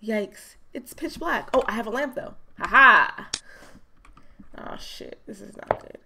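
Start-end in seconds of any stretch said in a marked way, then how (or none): 4.6-6.0 hushed, frightened whisper in the dark; scared whisper. Real, not acted. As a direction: breathe it out scared, barely voiced. Scared in dark game room.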